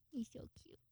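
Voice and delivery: tiny hushed gush; adoring whisper. Real, not acted. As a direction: whisper it, overcome by cuteness. Gushing over little cat.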